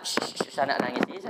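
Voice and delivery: soft whispered shushing; hushing. Retold or imitated. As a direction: hush a crying child. Hushing crying child.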